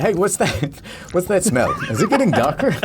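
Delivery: high-pitched, naive little fish; high curious fish voice. Pretend. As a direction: squeak it out like a clueless fish.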